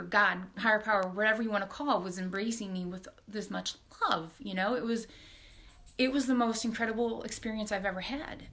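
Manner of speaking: ordinary talk, nothing marked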